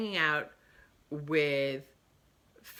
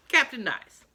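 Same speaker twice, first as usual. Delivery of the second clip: ordinary talk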